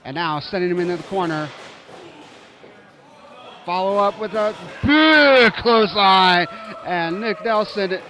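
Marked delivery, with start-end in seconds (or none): 3.6-8.0 loud. Real, not acted. Big clothesline lands.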